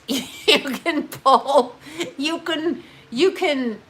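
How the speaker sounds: slow, laughing through the words; giggling. Real, not acted. Laughing at her own piercing joke. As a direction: giggle while saying it.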